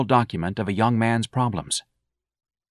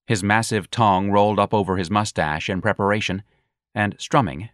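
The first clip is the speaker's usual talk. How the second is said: usual speaking voice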